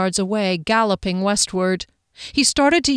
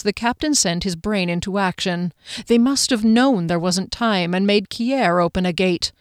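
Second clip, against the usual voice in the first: plain reading voice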